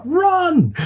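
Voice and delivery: sudden high yell; shouted warning. Retold or imitated. Storyteller yells to his friends to flee the angry resident. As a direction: yell it to get everyone moving. Someone came out screaming at them.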